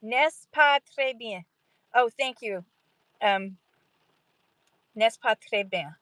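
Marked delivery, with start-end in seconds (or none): none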